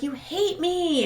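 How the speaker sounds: high, slow, teary imitation of crying; a hammed-up tearful plea; mock bawling. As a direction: play her sobbing it out, high and drawn out. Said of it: "then she starts bawling".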